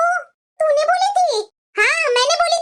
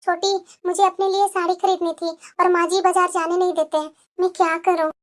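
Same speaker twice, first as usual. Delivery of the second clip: lower, timid voice of a downtrodden wife; meek elder daughter-in-law; 7 semitones lower than usual. Acted. Elder bahu to younger sister-in-law, meek and resigned. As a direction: sigh it out like a bullied bahu.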